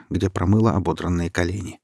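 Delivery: plain reading voice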